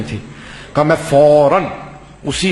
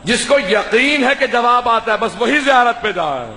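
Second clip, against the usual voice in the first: preaching; 7 semitones higher than usual. Acted. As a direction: preach it to the gathering with full fervour. (بلند آواز میں پرجوش خطابت)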